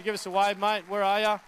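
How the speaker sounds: loud, high call into the crowd. Real, not acted. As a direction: call out to a mate across the room. Calling to someone in the crowd.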